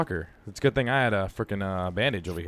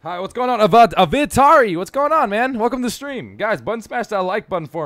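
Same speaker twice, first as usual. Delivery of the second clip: excited greeting; 8 semitones higher, 6 dB louder than usual. Real, not acted. Greeting chat viewers, bright streamer voice.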